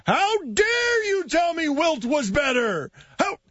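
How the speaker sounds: outraged fan, high, slow shout; barbarian. Retold or imitated. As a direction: roar it like a raging barbarian. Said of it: "Heat fan getting outraged".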